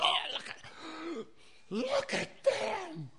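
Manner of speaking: high, slow, gasping alarm; high gasp of horror; alarmed pointing. Retold or imitated. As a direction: gasp and point at them, aghast. Pointing at the doomed people, gasping.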